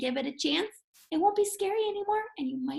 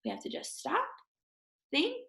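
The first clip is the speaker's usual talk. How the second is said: calm; 2 semitones higher, 5 dB quieter than usual. Shown as a demonstration. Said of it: "take a big deep breath".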